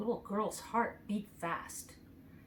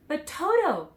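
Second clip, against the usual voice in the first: put-on higher, worried little girl; anxious; 8 semitones higher, 5 dB louder than usual. A narrator's reading. Dorothy cries out anxiously about Toto. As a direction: fret over her dog. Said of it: "said the girl anxiously".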